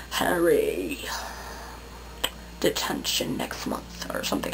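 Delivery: very low, hushed attempt at an actor's voice; Alan Rickman. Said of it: "I can't do Alan Rickman".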